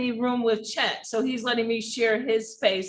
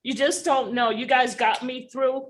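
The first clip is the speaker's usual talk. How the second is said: ordinary talk, nothing marked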